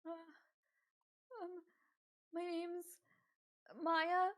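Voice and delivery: put-on high, hesitant teen girl; timid girl voice. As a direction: nervously give your name. Scared girl gives name.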